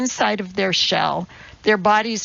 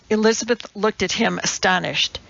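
ordinary delivery

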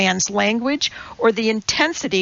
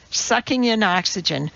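usual speaking voice

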